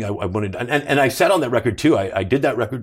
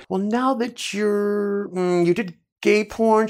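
much higher, very slow, drawn-out prim voice; Lisa Gibbons impression; 10 semitones higher, much slower than usual. TV host needling the ex-porn actor, high and drawn out. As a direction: put on her arch, pointed tone. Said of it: "she told me, she goes".

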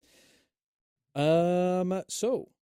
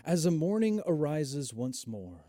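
game master narration. Acted. Game master's scene setting.

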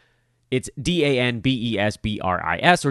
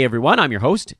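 usual speaking voice

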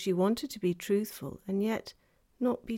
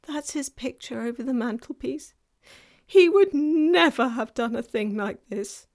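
higher, regretful faun voice; distraught faun; 5 semitones higher, 8 dB louder than usual. A narrator's reading. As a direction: lament with rising self-reproach. Faun laments about his father.

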